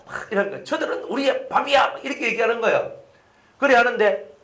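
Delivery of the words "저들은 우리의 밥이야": pitched-up imitation of a confident scout; high rallying cry, not shouted; retold urging. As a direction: insist on it in a high acted voice. Acting Caleb and Joshua urging brothers.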